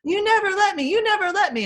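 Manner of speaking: put-on high, loud whiny child scream. Parent imitating a child screaming nonsense, high whiny yell. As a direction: yell it like a tantrum kid. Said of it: "they'll scream nonsense".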